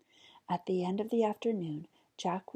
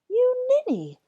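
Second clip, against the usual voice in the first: exasperated sigh; 10 dB louder than usual. Acted. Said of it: "sighed his mother".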